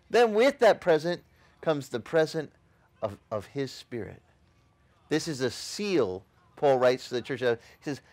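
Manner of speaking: ordinary talk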